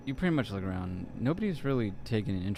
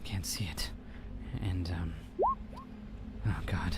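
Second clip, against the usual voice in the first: hushed, unvoiced trembling words; terrified whisper; 4 dB quieter than usual. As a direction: fade into a frightened whisper. Witness trails into whisper.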